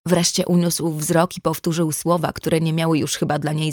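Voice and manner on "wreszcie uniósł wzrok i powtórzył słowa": ordinary delivery, nothing marked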